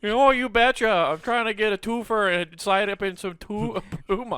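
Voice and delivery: high, loud hammed-up Canadian accent; over-the-top Canadian accent. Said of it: "random Canadian".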